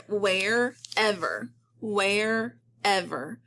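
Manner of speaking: WHEREVER split slowly into "where" and "ever"; syllable by syllable. Shown as a demonstration. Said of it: "I do hear two syllables".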